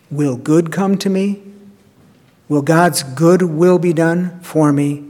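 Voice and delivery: ordinary talk, nothing marked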